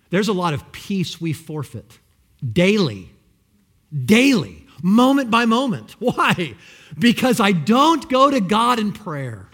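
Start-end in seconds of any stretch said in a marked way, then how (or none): 2.5-3.1 louder, firm daily; emphatic. Acted. Preacher names daily lost peace, emphatic. Repeated word, building. 4.0-4.7 daily again, louder and higher; stronger emphasis. Acted. Preacher repeats daily to the congregation, louder and higher. 4.8-9.5 loud, driving answer; fervent preaching. Acted. Preacher tells the congregation why, loud and fervent.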